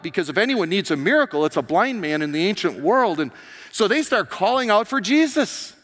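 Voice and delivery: loud, high, building sermon delivery; preaching at full force. Acted. Pastor stirring the congregation, preaching at full force. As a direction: preach it out to the back row.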